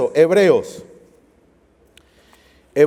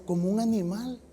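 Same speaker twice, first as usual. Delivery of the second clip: sad pity; 10 dB quieter than usual. Retold or imitated. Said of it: "mi esposa se puso bien triste".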